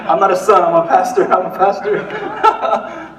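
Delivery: high, loud mock pleading through laughter; laughing self-restraint. Pastor provoked by his mother reminds himself, laughing self-restraint. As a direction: chant it to yourself while cracking up.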